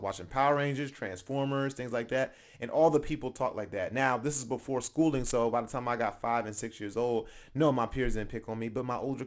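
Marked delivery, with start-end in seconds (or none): none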